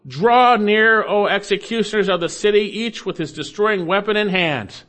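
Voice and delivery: calling out loud and high; loud cry. Shown as a demonstration. Preacher voicing the Lord's summons, cried out loud. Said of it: "It's a loud, cried out loudly".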